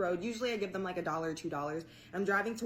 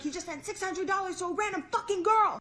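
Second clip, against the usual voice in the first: much higher, frantic retelling; retold panic; 11 semitones higher, 4 dB louder than usual. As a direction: replay the panic, pitched way up. Panicked retelling of own reaction.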